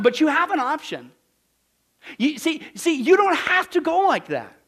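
loud, high urgent pulpit plea; impassioned preaching. Acted. Preacher urging the congregation, loud impassioned plea. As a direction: plead with them to choose.

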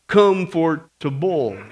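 "Comfortable" broken into slow, separate chunks; reading very slow. Acted. Sister reading a one-word telegram, "Comfortable" very slowly in pieces. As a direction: sound out "Comfortable" haltingly, like "come for da bull". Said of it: "She's gonna read it very slow".

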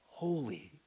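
a single soft low "holy."; hushed holy. Real, not acted. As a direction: breathe "holy." in awe. Reverent pronouncement.

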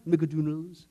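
McDonald's as Scottish "Mick-a-doo-nulls"; hammed-up Scottish McDonald's; jokey Scots "McDonald's" with oo vowel; mock Scottish accent. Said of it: "You've been to that Scottish restaurant".

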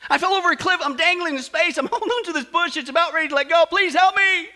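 high, loud, desperate cry; panicked pleading. Acted. Dangling tourist explains his fall to heaven, frantic pleading. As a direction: blurt it out in hammed-up panic. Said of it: "so the tourist pleads".

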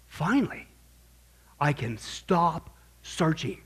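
slow, breathed-out relief; drawn-out, relieved exhalation of words; acted relief. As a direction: let it out slowly, as if a weight has lifted. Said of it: "this relief. It was like".

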